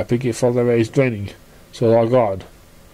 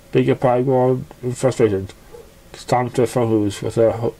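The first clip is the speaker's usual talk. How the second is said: plain reading voice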